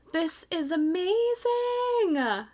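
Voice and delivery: high, drawn-out squeal; amazed delight. Real, not acted. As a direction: stretch it out high, overcome with delight. Awed by the new hook.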